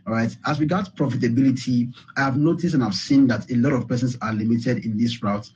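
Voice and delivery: ordinary delivery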